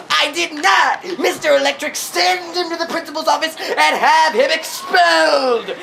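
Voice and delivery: high, loud mock denial; indignant yell. Accused classmate appeals to the teacher, indignant yell.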